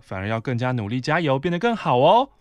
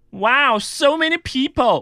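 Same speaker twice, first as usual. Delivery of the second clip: mock showy exclamation; 13 semitones higher, 5 dB louder, slower than usual. Coworker exclaiming in elevator.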